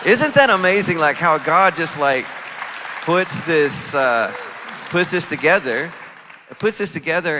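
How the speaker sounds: loud, high burst of wonder; amazed excitement. Retold or imitated. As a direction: exclaim it in astonishment, with halting emphasis. Retold amazed reaction, loud and high.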